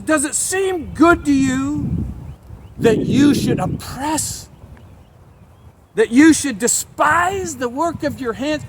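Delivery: slow, high, pleading accusation; accusing Job. Acted. Preacher voicing Job accusing God of oppression. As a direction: drag each clause out in protest.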